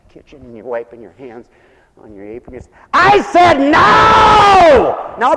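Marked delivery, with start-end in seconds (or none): 2.9-5.2 louder, much higher, slow drawn-out shout; mock yell. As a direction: yell at the kids like a fed-up mom. Mother yelling at kids watching TV.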